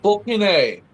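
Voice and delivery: high, exaggerated Australian accent; a clumsy try at Aussie; a hammed-up Australian voice. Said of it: "I'm terrible at it".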